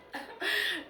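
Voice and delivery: short high burst of laughter. Real, not acted. Vlogger laughing at herself mid-rant, short high laugh. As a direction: break into a quick high laugh.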